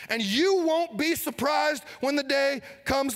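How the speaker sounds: high, loud, slow and fervent; fervent preaching. Acted. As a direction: drive it home from the pulpit. Preaching at full force.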